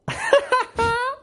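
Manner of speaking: a high burst of real laughter. Real, not acted. Laughing at the man from Ciudad Real, high laugh. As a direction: crack up at the story.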